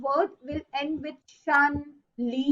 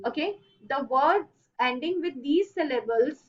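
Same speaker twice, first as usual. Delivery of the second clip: usual speaking voice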